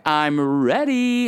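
high, drawn-out singsong call; imitating daughter yelling down. Daughter calling parents from bed, imitated singsong yell. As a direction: stretch it out like a kid calling downstairs. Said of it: "she yells down to Patty and I".

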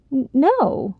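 nervous stammered no; stammering. Acted. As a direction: caught off guard, stutter it. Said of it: "Sylvie stammered".

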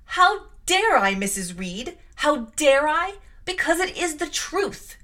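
loud, high, passionate tirade; furious accusation. Acted. Jane rages at her aunt.

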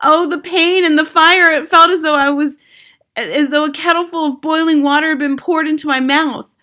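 high, loud, urgent telling of pain; agonized narration. A narrator's reading. Boy tells of potion burning through him, agonized narration.

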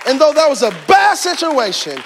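high, shouted declarations; ringing pulpit proclamation; full-force preaching. Real, not acted. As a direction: preach it triumphantly, stretching key words.

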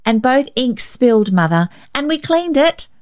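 put-on girl cheerfully telling her mother about the ink; cheerful child. A narrator's reading. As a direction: tell it like good news. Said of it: "she continued cheerfully".

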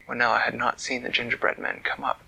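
plain reading voice

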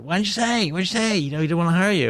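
the frantic agent on the phone; agitated agent. Retold or imitated. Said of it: "my agent rang and said".

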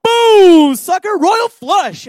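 triumphant gloat. Retold or imitated. God as poker player revealing the royal flush, loud gloating. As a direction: slam down the winning hand. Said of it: "And then he goes".

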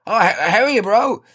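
high, eager bro greeting; trying too hard to sound like a lad; matey bro voice. Retold or imitated. Greeting the dish installer, overly matey.

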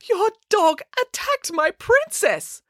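high, slow theatrical lament; outraged wail. A narrator's reading. Woman accuses April's dog, wailing. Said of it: "wailed the woman".

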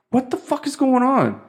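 high-pitched, incredulous mock outburst; retold exasperation. Acts out his reaction on bus.

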